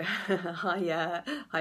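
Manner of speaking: ordinary talk, nothing marked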